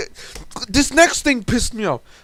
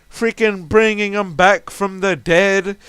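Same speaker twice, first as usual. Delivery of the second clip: ordinary delivery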